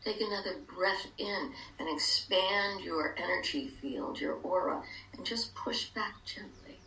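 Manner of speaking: calm guided meditation, hushed. Acted.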